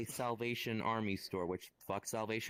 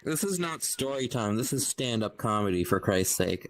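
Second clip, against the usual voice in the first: louder mock protest; mock indignant; 9 dB louder than usual. Real, not acted. Protesting to friends that this became stand-up, loud mock outrage. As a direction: complain loudly, half joking.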